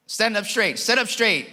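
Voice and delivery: high, firm coaching orders; father's command. Retold or imitated. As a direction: drill the boy on his posture. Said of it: "This is what I tell him".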